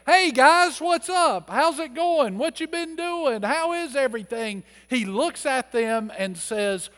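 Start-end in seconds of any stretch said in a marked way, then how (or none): 0.1-4.6 much higher, chirpy mock small talk; mock casual greeting. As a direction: ham up a breezy hey-guys chat. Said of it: "He doesn't say".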